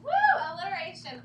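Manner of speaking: ordinary delivery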